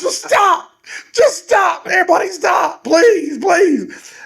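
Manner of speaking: high, shouted hammed-up plea; mock desperate begging. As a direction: imitate begging them all to stop. Acts boy begging everyone to stop.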